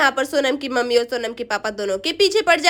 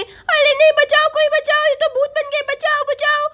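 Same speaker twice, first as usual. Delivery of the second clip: put-on high child's cry for help; panicking little Chinki; 12 semitones higher than usual. Girl chased by ghosts, panicked child voice.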